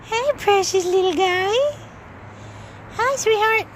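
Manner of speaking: high, loud cooing at the little pet. Real, not acted. Greeting her pet outside.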